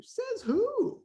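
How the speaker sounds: challenging disbelief. Real, not acted. Host challenging what the world says about teens, incredulous. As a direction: dare anyone to defend it.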